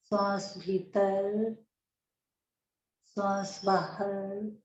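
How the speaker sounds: slow, drawn-out soothing meditation voice; guided meditation. Acted. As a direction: guide them gently into calm, stretching the words, long pauses. (धीमी, शांत ध्यान कराने वाली आवाज़)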